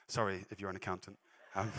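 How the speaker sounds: chuckling sorry to the accountants; laughing apology. Real, not acted. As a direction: apologise while laughing at his own joke.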